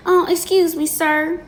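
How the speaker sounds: high, sugary put-on voice; mockingly timid, high-voiced request; mock polite questioner. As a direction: pitch it way up, overly polite and meek. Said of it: "that's how I talk. I, I talk like this".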